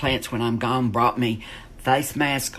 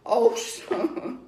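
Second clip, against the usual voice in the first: a higher, half-laughing, lightly voiced father; dad's weary plea; 7 semitones higher, slower than usual. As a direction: plead gently like a bewildered dad. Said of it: "My dad said".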